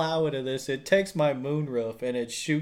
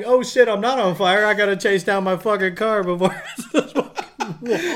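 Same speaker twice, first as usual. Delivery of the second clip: mock alarm; 6 semitones higher, 4 dB louder than usual. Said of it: "And I'm like".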